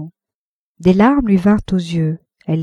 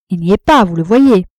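firm, louder dismissal; curt rebuff; 5 dB louder than usual. A narrator's reading. Wife telling her rival Arnoux is out, firmly dismissive. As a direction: state it firmly, as self-evident.

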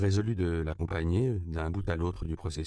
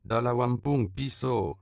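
higher, louder, slowly called out; child crying out; 6 semitones higher, 4 dB louder, slower than usual. A narrator's reading. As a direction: call out to the passing car. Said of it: "s'écria le premier gamin".

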